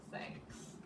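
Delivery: soft unvoiced thanks; tender whispered thank you. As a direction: breathe a grateful thanks. Bear comforted, quiet thanks.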